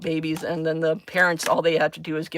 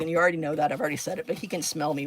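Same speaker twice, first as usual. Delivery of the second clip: ordinary talk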